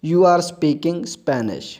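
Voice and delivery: very slow, careful reading for learners; slow model sentence. Shown as a demonstration. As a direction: lay each word down slowly. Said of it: "यानी".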